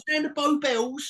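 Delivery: cheeky Cockney swagger; Cockney accent. Retold or imitated. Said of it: "Cockney swagger".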